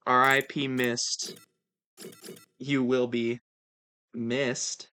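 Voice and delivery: slow, low, soft tribute; mock solemn farewell. Streamer mourns absent viewer Mist, mock solemn. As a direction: pronounce a hushed mock eulogy.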